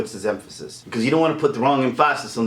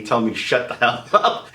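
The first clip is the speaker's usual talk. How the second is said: ordinary delivery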